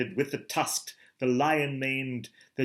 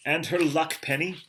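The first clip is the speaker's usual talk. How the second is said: ordinary delivery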